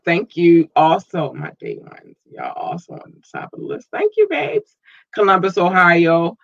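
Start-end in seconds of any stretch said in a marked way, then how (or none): 1.3-3.9 whispered aside to the day ones; hushed secret shout-out. Real, not acted. Aside to longtime fans. 3.9-4.8 squeaky thanks. Real, not acted. Cooing thanks to fans.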